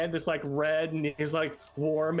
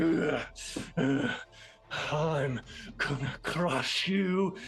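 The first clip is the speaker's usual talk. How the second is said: pained menacing threat; 2 semitones higher than usual. Acted. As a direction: growl through the pain. Butcher pulls bolt from eye.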